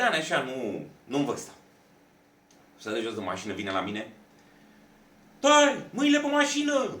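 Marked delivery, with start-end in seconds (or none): 5.4-7.0 high, loud barked police order; cop yelling. Retold or imitated. Police officer to the driver, barking a high, loud order. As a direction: bark it like an aggressive cop making an arrest. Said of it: "zbiera la mine".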